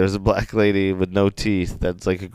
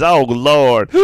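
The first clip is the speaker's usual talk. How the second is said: louder, higher mock dismay; exasperated lady; 4 semitones higher, 6 dB louder than usual. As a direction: imitate the scandalized boss lamenting. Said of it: "And then the lady's".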